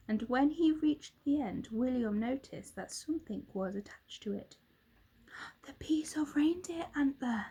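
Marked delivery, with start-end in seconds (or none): 5.6-7.5 breathless whispered gasp; awed whisper at the antler; amazed gasp. A narrator's reading. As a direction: gasp it in wonder. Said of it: "William gasped in amazement".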